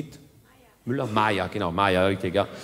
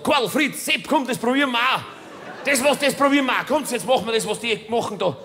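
higher, eager calling the friends over; hammed-up locals egging each other on; mock villagers keen to try clapping; high excited villager; 7 semitones higher than usual.

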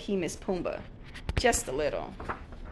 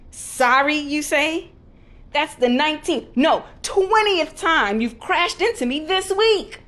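higher, louder indignant rant, slowing at the end; angry shouting; 8 semitones higher, 8 dB louder than usual. Acted. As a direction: rage at the clumsy warthog. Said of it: "shouted Timon".

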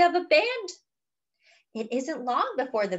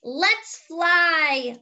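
smiling Luna; 6 semitones higher, 4 dB louder than usual. Acted. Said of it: "Luna smiles".

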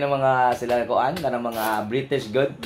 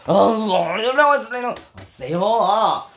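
high, sing-song fake Korean; mock Korean accent; 7 semitones higher, 4 dB louder, much slower than usual. Showing the tone Koreans talk in, mock Korean accent. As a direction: stretch the endings in exaggerated Korean intonation. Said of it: "Ingani lang tono mo istorya".

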